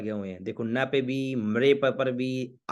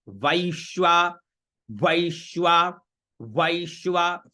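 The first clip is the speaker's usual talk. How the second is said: वैश्वा slow, leaning hard on the "sh"; stress on श; much slower than usual. Shown as a demonstration. Teacher drilling वैश्वा, slow with weight on श. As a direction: draw out वैश्वा as vaish-shvaa, pressing श. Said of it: "श पे जोर देना है".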